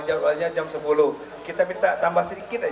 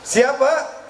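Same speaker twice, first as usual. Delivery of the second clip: pitched-up rousing shout; rallying call-out; 8 semitones higher than usual. Real, not acted. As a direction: call it out high and rising for a crowd reply. Rallying the crowd to answer.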